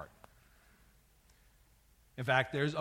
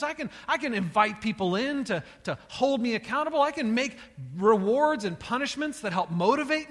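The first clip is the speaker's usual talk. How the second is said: raised, louder preaching voice pressing hard; rising fervent sermon delivery; passionate preaching; 8 semitones higher, 6 dB louder than usual. Acted. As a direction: preach it with full force, higher and louder. Preacher builds to a passionate climax.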